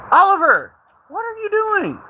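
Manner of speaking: high shout at the dog; calling out. Real, not acted. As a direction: call the dog from across the yard. Yelling at dog outside.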